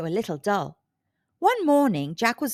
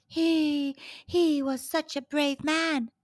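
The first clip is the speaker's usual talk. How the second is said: sighing; 4 semitones higher, slower than usual. A narrator's reading. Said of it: "she sighed".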